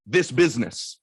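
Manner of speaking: ordinary talk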